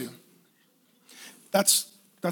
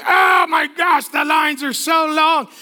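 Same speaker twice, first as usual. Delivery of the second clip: put-on slow, high, loud child's whine; whining kid; 4 semitones higher, 3 dB louder, slower than usual. Kids on the plane whining loudly about the long lines. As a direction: drag it out like a bored kid. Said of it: "They're like".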